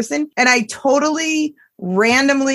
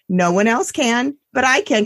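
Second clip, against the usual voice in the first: ordinary talk, nothing marked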